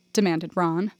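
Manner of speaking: ordinary delivery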